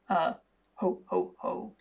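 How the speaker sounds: a hollow, half-hearted ho ho ho; lightly voiced Hogfather chuckle. A narrator's reading. Figure's ho ho ho.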